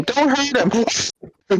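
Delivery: high, slow pleading cartoon hero; Sonic voice. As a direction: squeak it out like Sonic. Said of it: "Sonic coming out saying".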